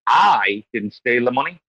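emphatic "I", then run through "didn't steal the money"; stress on I. Shown as a demonstration. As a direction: lean on the word "I". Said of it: "with five different, like, word stresses".

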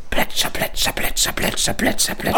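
whispered splashing sounds, like a pedal boat; water splashing. Acted. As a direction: breathe the splashes softly as the boat. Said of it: "Ich bin das Tretboot". (geflüstertes Plätschern als Tretboot)

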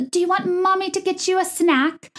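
high, cooing sing-song mom; mock mommy voice. Podcaster mocking reality TV moms offering snacks, syrupy mommy voice. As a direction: gush it in a high-pitched baby-talk put-on. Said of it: "people don't talk like that".

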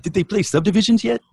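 high, loud mock Canadian fan; mock Canadian accent. Teasing Canadians, high loud mock Canadian. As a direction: ask it like an eager Canadian.

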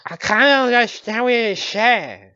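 high, loud cartoon duck voice; Donald Duck impression. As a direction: quack the words out like a cartoon duck. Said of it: "that's my bad Donald Duck impression".

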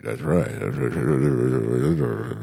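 slow low voice. Pretend.

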